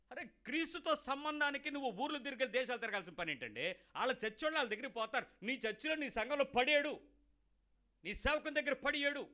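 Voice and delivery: loud, high, impassioned pulpit exhortation; fiery preaching. Acted. Preacher rebuking the congregation, loud fiery preaching. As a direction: thunder it at the congregation.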